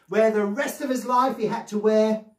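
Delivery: plain reading voice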